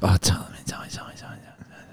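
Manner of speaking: hushed, urgent whisper fading to almost nothing; conspiratorial whisper. Pretend. Podcast co-host begging for gossip, whispering. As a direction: whisper it eagerly, as if someone might overhear. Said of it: "you guys are whispering".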